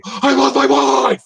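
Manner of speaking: loud, high, slow mock yell; retold scream. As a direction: bellow it like a vow to the room. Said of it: "I'll look her in the eyes and scream".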